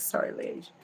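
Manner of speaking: whispered apology aside. Real, not acted. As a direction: whisper a quick sorry. Aside while searching.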